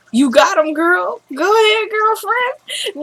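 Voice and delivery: high-pitched sing-song teasing; mock cheering. Woman taunts crying man, high mock girlfriend cheer. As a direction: egg him on in a high mocking voice.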